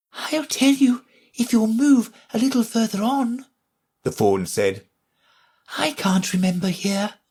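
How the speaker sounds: high, light fawn voice. A narrator's reading. Fawn asking Alice to think again, high and light.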